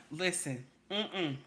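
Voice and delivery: usual speaking voice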